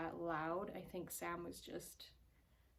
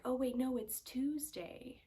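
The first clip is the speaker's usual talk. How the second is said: a slightly raised, drawn-out quote; George's odd line; 6 semitones higher, 5 dB louder than usual. Retold or imitated. Friend quoting George to the group, mildly drawn out. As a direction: lightly mimic George, stretching Tuesday. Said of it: "George would be like".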